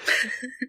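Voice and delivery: laughter bursting out high. Real, not acted. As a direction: let the giggle break free.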